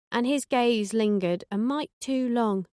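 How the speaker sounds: ordinary delivery, nothing marked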